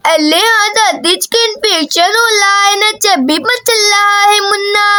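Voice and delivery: put-on childish baby-talk lisp; lisping little girl. Daisy answers her grandmother.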